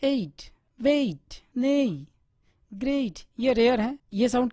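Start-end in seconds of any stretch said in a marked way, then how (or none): 0.0-1.4 एट, वेट drawn out very slowly with a changed tone; teacher modelling words slowly; slow demo. Shown as a demonstration. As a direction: stretch out एट and वेट. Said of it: "इसका सबसे बढ़िया example है".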